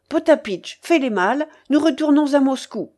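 urgent bossy instructions; impatient orders. A narrator's reading. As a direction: order the servants about imperiously. Impatient mistress orders her servants.

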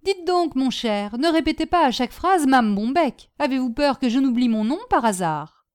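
a lightly voiced, annoyed hostess; irritated Madame Bombec. Hostess scolding a guest over her repeated name, mildly irritated.

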